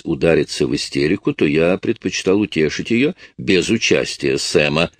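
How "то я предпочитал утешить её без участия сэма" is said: plain reading voice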